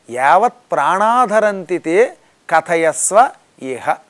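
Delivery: slow chanted Sanskrit line; slow devotional chant; verse recitation. Acted. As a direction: intone the verse slowly.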